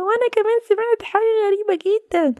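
put-on high small child's voice, worried; a kid chiming in about the noise; little child voice. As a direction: younger sibling telling the others.

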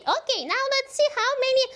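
high, quick sing-song; kindergarten teacher voice. Acted. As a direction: keep the counting game bouncy.

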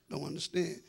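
usual speaking voice

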